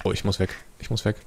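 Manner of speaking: usual speaking voice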